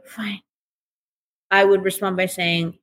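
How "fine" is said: a soft, airy mock "Fine"; imitating a pouting partner, breathy and clipped; breathy sulk. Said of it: "why are you talking all breathy then?".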